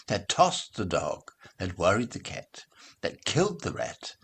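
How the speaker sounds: ordinary delivery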